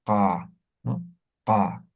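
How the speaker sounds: "Ka" low from the back of the throat. Shown as a demonstration. Teacher repeats the postvelar ka for the students. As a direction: drop "Ka" deep into the throat. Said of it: "Ahí es donde sale el sonido".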